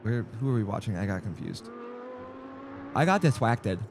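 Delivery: normal talking voice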